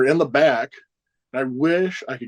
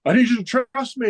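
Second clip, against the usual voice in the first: retold yell; 11 semitones higher than usual. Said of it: "he keeps yelling".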